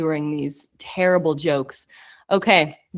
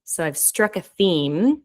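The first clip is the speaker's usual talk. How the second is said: ordinary talk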